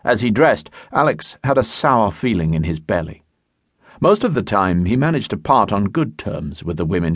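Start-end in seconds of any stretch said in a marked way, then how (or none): none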